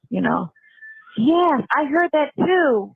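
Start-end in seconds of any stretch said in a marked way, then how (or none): 1.2-2.9 mock minion. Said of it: "the minions that just".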